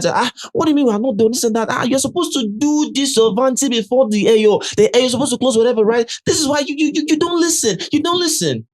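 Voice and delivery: high, fast imitated scolding; hammed-up telling off; mock scolding. Streamer retells Koin scolding Dede, high fast mock scolding. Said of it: "K was now telling her".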